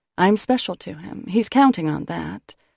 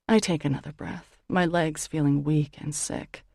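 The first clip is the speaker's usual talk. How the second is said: ordinary delivery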